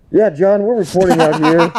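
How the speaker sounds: news reporter. Acted. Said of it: "like, on the scene".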